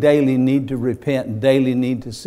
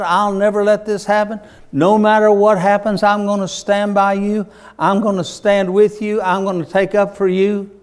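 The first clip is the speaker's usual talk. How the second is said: fervent boast; 7 semitones higher, 4 dB louder than usual. Acted. Peter's boasting vows voiced.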